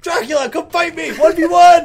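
mock challenge shout. Acting out Thor challenging Dracula, high mock shout. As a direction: yell a playful, over-the-top challenge.

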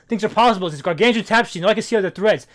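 loud, high, insistent quoted speech; loud quote. Retold or imitated. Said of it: "He's like".

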